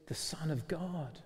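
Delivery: slow, breathless whisper of wonder; awed whisper. Retold or imitated. Peter realising Jesus is God's Son, whispered in awe. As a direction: breathe it out as if you can barely believe it.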